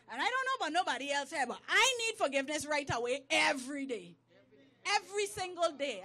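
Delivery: loud, forceful declaration to a congregation; emphatic preaching. Real, not acted. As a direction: ring it out loud and insistent.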